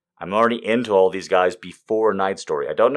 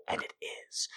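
whispered aside; 19 dB quieter, faster than usual. Real, not acted. Aside about awful movie.

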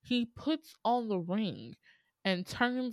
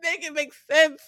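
exasperated plea; 9 semitones higher, 7 dB louder than usual. Real, not acted. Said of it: "repeated exasperated plea".

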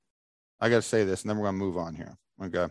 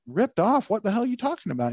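retold disbelief; 10 semitones higher, faster than usual. Own retold indignant reply.

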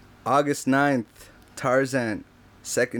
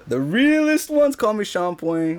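higher, slow show-off line; playful boast; 8 semitones higher, slower than usual. Real, not acted. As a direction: savor the silly nickname. Jokey name reveal.

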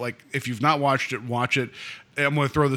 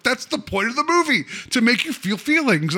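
higher, hammed-up schooling tone; mock lecturing; 9 semitones higher than usual. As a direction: scold her playfully like a know-it-all. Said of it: "I'm like".

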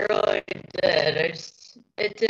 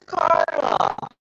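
much lower, slow horror-host intro; spooky host voice; slower than usual. Pretend. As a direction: lower the voice into a creepy announcer. Introducing the cat in character.

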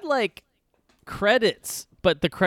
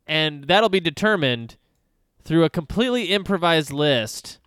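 show host intro; 6 semitones higher, 4 dB louder than usual. Acted.

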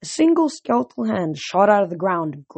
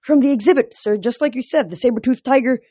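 higher-pitched, anxious underling voice; nervous guard; 6 semitones higher, 4 dB louder than usual. A narrator's reading. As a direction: answer the boss pleadingly, pitched up. Frightened guard answers the general.

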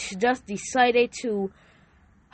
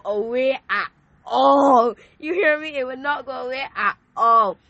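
choked up; 3 semitones higher, 6 dB louder than usual. Real, not acted. Retelling desperate sore throat.

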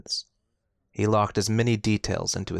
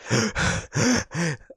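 dying gasp; 8 semitones higher than usual. A narrator's reading. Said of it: "His breaths were slow and raspy".

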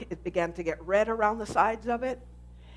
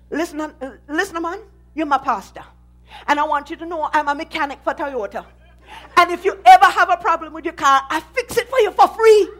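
put-on loud, high Jamaican accent; 6 semitones higher, 10 dB louder than usual. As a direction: Jamaican mechanic shouting. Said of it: "in his heavy Jamaican accent".

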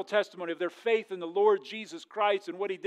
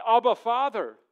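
louder, higher cry to the Father; raised, reverent cry; 4 semitones higher, 8 dB louder than usual. Retold or imitated. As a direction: call it out with fervor. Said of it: "whereby we cry".

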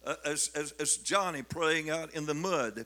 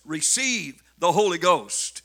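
loud ringing proclamation; Holy Ghost called out loud to the church; proclaiming; 9 dB louder than usual. Acted. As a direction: announce the promise with full force. Preacher proclaims the promise.

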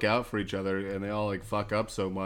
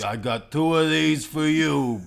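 loud, high, slow mock taunt; angry cop; 6 semitones higher, 7 dB louder, slower than usual. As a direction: draw it out defiantly, imitating an angry cop. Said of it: "that one angry".

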